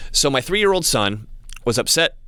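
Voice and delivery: normal talking voice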